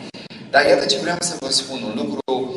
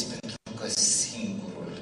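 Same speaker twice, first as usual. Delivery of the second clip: tender mother; 2 semitones lower, 10 dB quieter than usual. Retold or imitated. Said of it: "Mama s-a uitat la ea și a zis".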